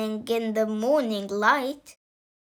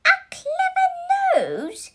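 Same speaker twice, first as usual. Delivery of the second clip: incredulous mother. Acted. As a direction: squeal it in disbelief. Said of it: "his mother hissed".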